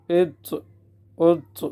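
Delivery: heavy t with echoing release; qalqalah on taa. Shown as a demonstration. Teacher drills correct qalqalah, bouncing the t. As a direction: bounce each closing t. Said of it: "قلقلہ کرنا پڑے گا".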